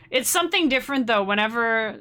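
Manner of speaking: normal talking voice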